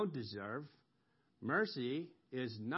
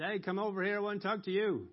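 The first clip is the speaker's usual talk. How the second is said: higher, casual call to someone; calling someone over; 8 semitones higher, 4 dB louder, faster than usual. Retold or imitated. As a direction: hail someone across a distance.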